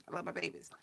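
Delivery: I love my babies whispered. Real, not acted. Repeats it tenderly.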